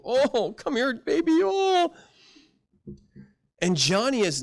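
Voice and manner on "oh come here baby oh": cooing mock comfort, rising high; mock coddling. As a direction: sneer at a parent coddling a child. Mocking a coddling parent.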